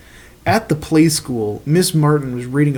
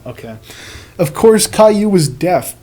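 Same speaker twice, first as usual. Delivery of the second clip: ordinary talk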